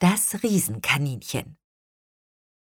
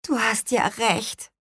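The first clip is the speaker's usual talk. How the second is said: sheepish admission; 7 semitones higher than usual. A narrator's reading. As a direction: admit it reluctantly. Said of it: "gestand sie".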